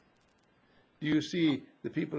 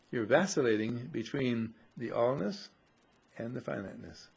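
ordinary talk, nothing marked

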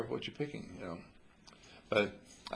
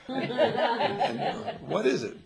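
laughing; 12 semitones higher, 7 dB louder, much slower than usual. Pretend. Mocking the disappointing prize.

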